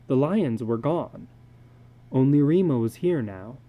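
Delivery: plain reading voice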